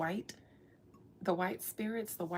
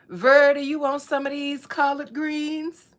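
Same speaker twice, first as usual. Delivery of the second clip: loud, higher grandma offering greens; playful, much louder grandma voice; grandma offering food; 5 semitones higher, 13 dB louder than usual. Acted. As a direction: call out like a grandma at the stove.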